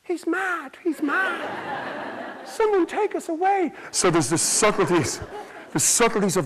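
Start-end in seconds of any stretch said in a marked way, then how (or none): 0.0-3.7 put-on high, far, laughing old-lady voice; old woman in a wheelchair, high and laughing; elderly whisperers. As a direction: squeak it like a shocked old woman. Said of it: "You know what they were saying".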